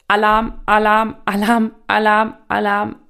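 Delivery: subconscious raising alarm. Acted. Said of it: "Dein Unterbewusstsein wird sofort sagen".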